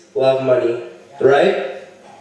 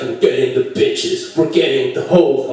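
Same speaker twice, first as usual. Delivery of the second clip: mock rapper; 4 semitones lower than usual. Mocking the rap song lyrics.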